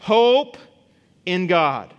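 HOPE rung out high and loud, then a pause; emphatic command. Acted. Psalmist gives his soul the solution, emphatic command. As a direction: proclaim the answer loudly, word by word. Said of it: "he gives the solution".

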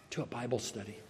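low, barely audible mumble; mumbled. Retold or imitated. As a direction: mumble it, embarrassed. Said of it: "And I mumbled".

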